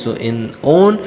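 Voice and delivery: ordinary talk, nothing marked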